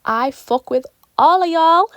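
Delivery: squealing with excitement; hyped shout-out. Real, not acted. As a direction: blurt it out over the moon. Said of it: "you get me so hyped and excited".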